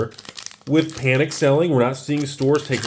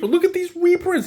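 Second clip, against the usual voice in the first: much higher, thrilled look-at-this; excited. Real, not acted. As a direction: show it off, delighted. Excited showing cards.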